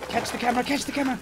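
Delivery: high, loud cry; frantic shout. Real, not acted. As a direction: yell as it falls. Dropped camera while jumping.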